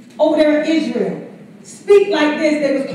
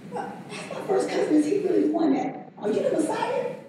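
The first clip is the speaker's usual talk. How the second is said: a lightly voiced doubtful John, softer and higher; half-whispered skeptical questioning in John's voice; John unsure, softly asking if his cousin is the Messiah; 4 semitones higher, 9 dB quieter, faster than usual.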